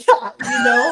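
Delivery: laughing hard. Real, not acted. Joking with the host about being hyped, laughing. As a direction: squeal with laughter, pitch shooting up.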